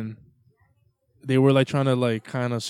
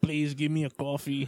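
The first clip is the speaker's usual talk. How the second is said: put-on Cuban accent; 3 semitones higher, 3 dB quieter than usual. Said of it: "That's my Cuban accent".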